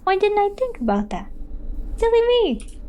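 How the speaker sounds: sarcastic. Pretend. Sarcastic reply to chat's advice.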